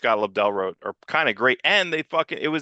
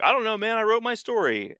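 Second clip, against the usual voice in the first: mock old writer shrug; 9 semitones higher than usual. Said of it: "he's like".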